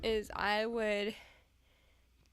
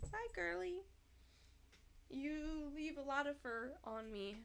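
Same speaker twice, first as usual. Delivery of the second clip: pet talk; 5 semitones higher, 9 dB quieter than usual. Real, not acted. Talking to departing cat.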